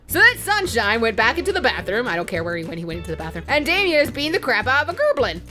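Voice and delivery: usual speaking voice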